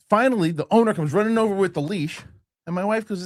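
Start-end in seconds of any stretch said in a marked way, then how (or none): none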